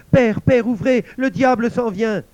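high, very loud plea; panicked cry. Acted. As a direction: cry for the door to open, desperate. La fille supplie son père d'ouvrir. (appel affolé, aigu et très fort)

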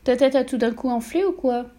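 voix d'enfant plus aiguë, légère; petite fille faussement innocente. A narrator's reading. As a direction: questionne d'un air innocent. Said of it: "demanda-t-elle". (Mathilda taquine son père, l'air innocent)

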